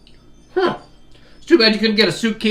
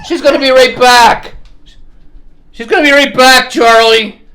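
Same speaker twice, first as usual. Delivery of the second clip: talking to the dog; 8 semitones higher, 11 dB louder than usual. Real, not acted. Reassuring the whining dog.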